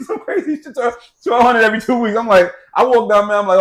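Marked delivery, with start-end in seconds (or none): 0.1-2.2 high, loud disbelief at the sum; almost squealing at the number; incredulous outburst. Real, not acted. Husband telling wife about the payment, incredulous and loud. Outraged retelling of child support amount.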